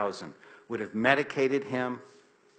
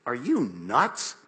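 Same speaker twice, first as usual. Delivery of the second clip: incredulous; 4 dB louder, slower than usual. Retold or imitated. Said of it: "I'm like".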